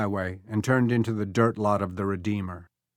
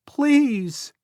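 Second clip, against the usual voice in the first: put-on high child's plea; boy pleading; 5 dB louder than usual. A narrator's reading. Lost boy pleads with Becky. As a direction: ask for help, small and lost. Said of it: "the boy said".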